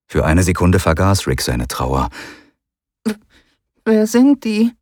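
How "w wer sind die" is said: put-on high stammering child voice; a scared boy asking; small child voice, stuttering start; frightened boy. Rick child voice held.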